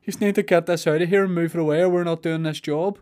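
high, fast panicky workman voice; scared workmen. Retold or imitated. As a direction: mimic frightened workmen begging to move it. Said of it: "why were they like".

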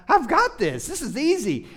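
high, pumped-up boast; cocky excitement. Retold or imitated. Runner boasting to himself at mile five, high cocky excitement. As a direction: play up the runner's easy confidence.

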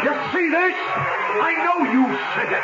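high, shouted accusation; angry confrontation. Acted. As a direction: shove the note in his face. Owner confronting rival with note.

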